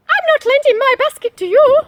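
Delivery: put-on high, loud girlish refusal. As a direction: refuse her bossily, high.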